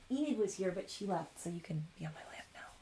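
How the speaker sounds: fading to a whisper to the cat; soft words sinking to a whisper; soft pet talk. Real, not acted. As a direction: hush down to the cat on your lap. Talking softly to cat.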